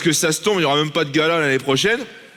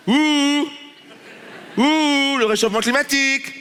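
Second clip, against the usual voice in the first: raised hammed-up worry; mock alarmed; 6 semitones higher, slower than usual. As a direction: overplay the panic about the climate. Mock alarm about warming.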